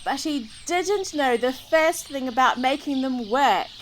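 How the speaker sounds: ordinary delivery, nothing marked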